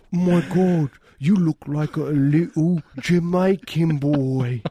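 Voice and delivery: hammed-up mother with a fake Jamaican accent; mock mother's accent. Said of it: "his mother's like".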